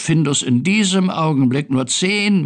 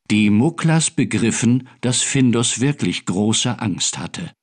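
ordinary delivery

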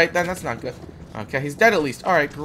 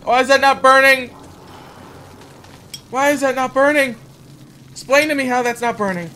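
raised, higher frustrated demand; exasperated; 6 semitones higher, 4 dB louder than usual. Real, not acted. Player asks why it is not burning, exasperated. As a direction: demand an answer, pitched up in frustration.

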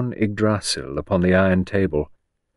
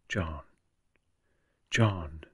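much softer, almost to herself; under her breath; 3 semitones lower, 10 dB quieter than usual. Acted. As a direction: breathe the name, barely audible. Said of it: "She said under her breath".

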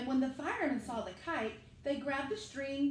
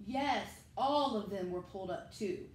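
ordinary delivery

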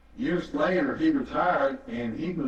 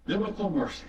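higher, ringing pulpit voice; full-force preaching; 6 semitones higher than usual. Acted. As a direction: drive the message home to the congregation.

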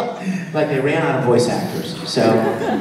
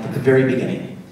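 usual speaking voice

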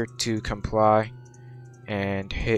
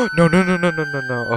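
panicked; 6 semitones higher than usual. Real, not acted. Nearly crashes into tree.

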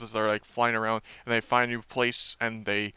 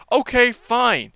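huffy Hiccup; 5 semitones higher, 4 dB louder than usual. Retold or imitated. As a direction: storm off in a sulk. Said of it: "Hiccup's like".

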